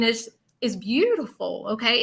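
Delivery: normal talking voice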